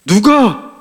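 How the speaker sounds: high, hammed-up outrage breaking into a laugh; mock anger. God as father jumping up for his son David, mock angry. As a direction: play an indignant protective father, half laughing. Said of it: "하나님께서 갑자기 화가 나가지고 벌떡 일어난".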